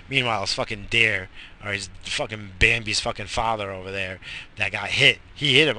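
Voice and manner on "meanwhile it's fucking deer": normal talking voice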